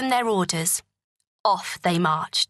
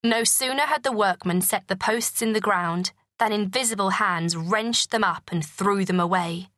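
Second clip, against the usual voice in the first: usual speaking voice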